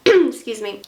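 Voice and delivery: ordinary delivery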